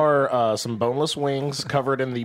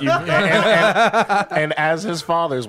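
laughing; 4 semitones higher, 4 dB louder, slower than usual. Real, not acted. Laughing through mock fatherly speech.

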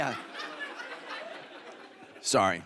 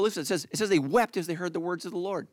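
usual speaking voice

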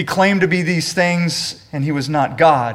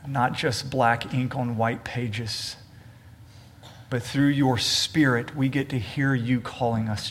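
prayer; 6 semitones lower, 6 dB quieter than usual. Acted. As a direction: lead the church gently in prayer. Leads congregation in prayer.

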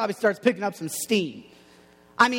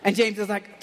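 ordinary talk, nothing marked

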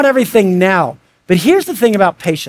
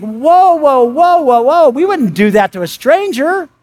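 mock alarm; 6 semitones higher, slower than usual. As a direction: pull yourself up short in mock alarm. Acting out yelling at family.

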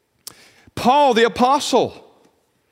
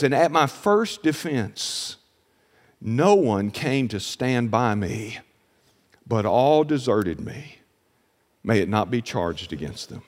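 much softer, slow and low, almost confiding; a slow, softened reading of abandonment; hushed lament; 10 semitones lower, 5 dB quieter than usual. Acted. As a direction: let Paul's desertion fall quiet and slow, lower and lower. Listen to this, Paul's desertion.

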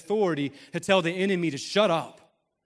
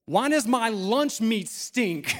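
put-on higher, very slow child's question; imitated yelling five-year-old; 5 semitones higher, much slower than usual. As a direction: drag out a little girl's indignant public question. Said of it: "yelling across all her friends".

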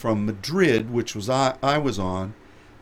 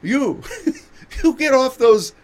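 high, loud laughing mock of an Indian announcer; laughing through a high mimicry of her accent; imitating Indian accent; 9 semitones higher, 4 dB louder than usual. Airport announcer scolding passengers, high laughing Indian accent mimicry. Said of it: "I could imitate her".

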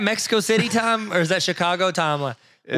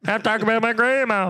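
higher-pitched mock celebrity voice; Jamie Foxx impression; 6 semitones higher than usual. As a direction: have another go at imitating the actor. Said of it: "Jamie Foxx is like".

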